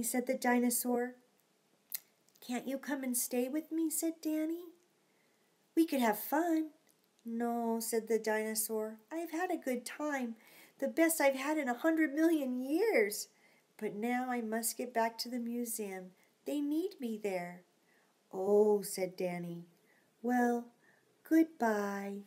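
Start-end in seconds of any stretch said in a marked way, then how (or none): 2.4-17.6 quiet, sad farewell; soft and sad. Acted. 18.3-22.2 sad. Acted. Danny's sad goodbye.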